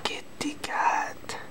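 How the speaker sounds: slow, drawn-out whisper; whispering to a cat. Pretend. Cooing at the kitty cat on the desk, slow whisper. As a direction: whisper slowly, as if to a pet.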